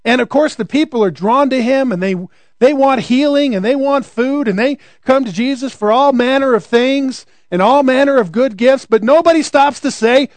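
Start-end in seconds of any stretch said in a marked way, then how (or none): none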